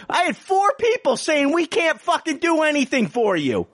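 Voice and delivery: very high-pitched, outraged retelling; mock their refusal in a high, worked-up voice; exasperated rant.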